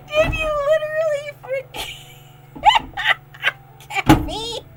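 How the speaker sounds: laughing. Real, not acted. Streamer laughing at a chat message, asking through laughter.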